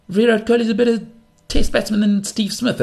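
high-pitched mock fan voice; mocking imitation. Imitating an opponent's cricket claim.

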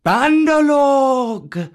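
high, loud, drawn-out call; Kaa the python. Acted. Kaa calling the monkey people, long commanding shout. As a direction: summon them with one long cry. Snake calls the monkeys to order.

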